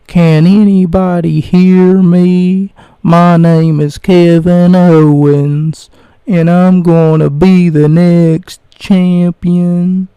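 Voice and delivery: slow loud shouting wrestler; Kevin Owens impression. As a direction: bellow slowly like a wrestler promo. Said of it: "he's talking like this".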